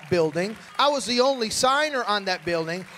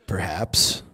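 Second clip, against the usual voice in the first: much lower, booming self-important "Yes... It is I"; deep reverend voice. Retold or imitated. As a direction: drop into a grand deep pastor voice. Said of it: "my voice changes".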